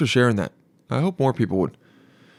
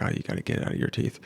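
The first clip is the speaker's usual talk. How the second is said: quick, unvoiced whisper, much softer; 9 dB quieter, faster than usual. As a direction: lean in and whisper it fast. Finally whispers to her.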